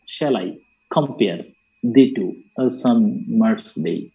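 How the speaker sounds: "শেল আই, compare, the two, a sun, Mars, day" low, split two syllables at a time with pauses; metrical scansion. Shown as a demonstration. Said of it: "দুইটা syllabus মিলে একটা মাত্রা হয়".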